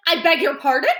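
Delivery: indignant lady; offended. Acted. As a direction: sniff with offence. Offended caller.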